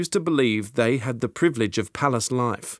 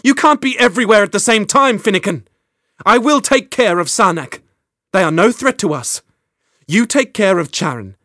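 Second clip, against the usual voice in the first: heated insistence; 9 semitones higher, 7 dB louder than usual. Acted. Queen's heated reply to Finnegan.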